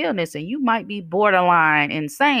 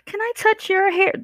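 much higher, mocking nosy stranger voice; mock intruder. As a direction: mimic the clueless stranger asking, way up high. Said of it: "And somebody come".

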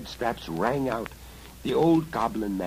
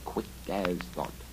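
ordinary delivery

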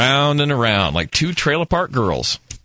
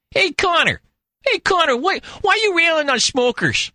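much higher mock voice of a radio colleague; imitating Bruce, pitched way up; impersonating Bruce. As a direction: ham up the pushy coworker. Radio host voiced in retold story.

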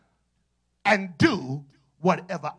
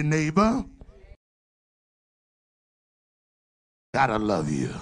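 prompted greeting; 4 semitones lower than usual. Shown as a demonstration. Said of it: "Look at your neighbor and say".

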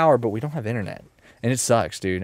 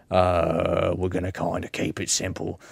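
плохо сыгранный австралийский акцент, мягче и тише; bad Australian accent; 3 semitones lower, 3 dB quieter than usual. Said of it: "this character I'm doing right now".

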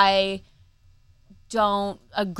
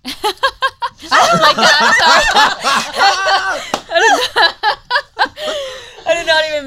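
high-pitched squealing laugh; 13 semitones higher, 8 dB louder, slower than usual. Real, not acted. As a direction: laugh high and loud through the words. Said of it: "I'm the one with the annoying laugh".

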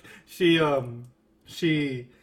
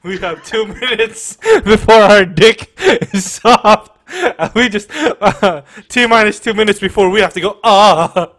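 louder rushed groaning; mock climax groans, louder; mock panic moan; 4 semitones higher, 12 dB louder than usual. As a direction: ham up a guy about to finish. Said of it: "we're like".

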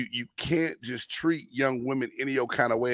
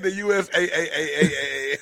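laughing, stretched-out letters; 4 semitones higher than usual. Retold or imitated. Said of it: "He said".

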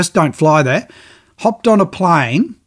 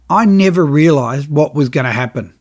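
usual speaking voice

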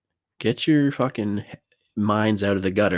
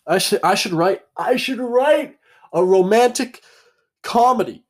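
excited announcement; 11 semitones higher, 5 dB louder than usual. Real, not acted. Grand self-pleased announcement.

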